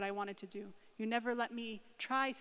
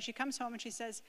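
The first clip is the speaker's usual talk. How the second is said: ordinary talk, nothing marked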